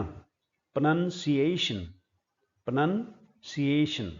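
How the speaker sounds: प्रोनाउंसिएशन said slowly, syllable by syllable; slow careful प्रोनाउंसिएशन; correct pronunciation. Shown as a demonstration. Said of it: "ये शब्द होता है प्रोनाउंसिएशन". (धीरे धीरे साफ़ प्रोनाउंसिएशन)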